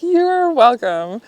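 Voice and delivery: loud, slow, every word leaned on; emphatic reply. Retold or imitated. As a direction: say it warmly, word by word. Her reply to the stranger.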